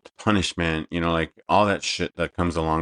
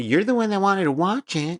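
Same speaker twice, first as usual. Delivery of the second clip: squeaky high mock voice; 13 semitones higher than usual. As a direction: whine it in a high pitch. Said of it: "what'd you say to me".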